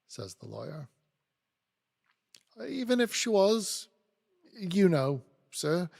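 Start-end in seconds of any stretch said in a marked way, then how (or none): 2.6-5.9 higher, louder plea; pleading. A narrator's reading. Snagsby entreats the lawyer, pleading. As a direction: plead anxiously with a superior. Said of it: "Mister Snagsby pleads".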